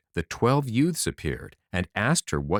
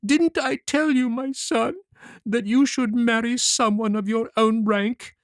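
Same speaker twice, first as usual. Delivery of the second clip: a weeping mother lamenting her fate; sobbing old mother; 13 semitones higher than usual. A narrator's reading. Mother sobbing to her son Martin, tearful and high. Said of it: "and sobbed out".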